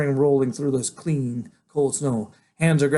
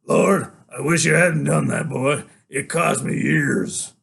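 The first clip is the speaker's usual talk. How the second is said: sighing father; 3 semitones higher, 6 dB louder, slower than usual. Acted. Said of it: "My father sighs and said".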